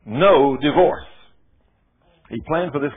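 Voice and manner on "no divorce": loud, slow commanding verdict; God's stern loud ruling to the lawyer; stern, not gentle. Retold or imitated. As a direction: stamp each word, no discussion. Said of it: "God wasn't nice and gentle with me either".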